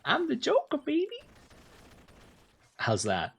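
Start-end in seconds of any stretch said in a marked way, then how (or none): 0.0-1.2 much higher, hammed-up villain voice; Joker impression. Chat asks for a Joker impression, squeaky and hammy. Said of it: "Give me a Joker impression".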